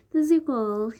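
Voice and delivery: bright sign-off call; signature sign-off. Pretend. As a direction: sing out your channel tag. Vlog sign-off catchphrase.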